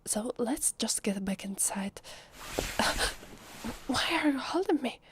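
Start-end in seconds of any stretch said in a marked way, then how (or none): 2.0-3.8 breathy gasp of surprise; startled gasp. Acted. Suddenly held while leaving. 3.9-5.0 high, flustered protest; startled question. Acted. Startled at being held back.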